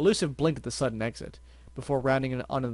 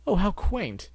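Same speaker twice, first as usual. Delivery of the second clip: chuckling; 8 semitones higher, 3 dB louder, much slower than usual. Acted. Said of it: "Elusive chuckled".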